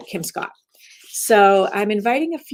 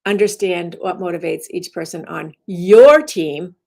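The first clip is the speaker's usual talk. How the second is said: "your" louder and rising; stress on your; 6 dB louder than usual. Shown as a demonstration. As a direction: claim it: "your" team. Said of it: "you emphasize it to change the meaning".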